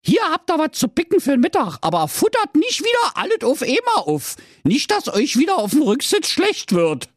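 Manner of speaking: very high, put-on mother voice; nagging mother. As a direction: admonish the children in a high, fussing pitch. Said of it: "gab sie ihnen eine Tüte".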